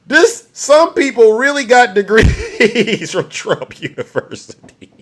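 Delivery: slow, laugh taking over the words, fading at the end; breaking into laughter. Real, not acted. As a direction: struggle to finish through real laughter. Repeats it, overcome with laughter.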